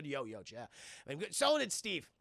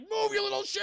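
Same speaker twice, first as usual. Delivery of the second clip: high, loud mock command; hammed-up panicked order; urgent order; 12 semitones higher, 5 dB louder than usual. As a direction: yell at kids to get moving. Meg shoves boys away from blob.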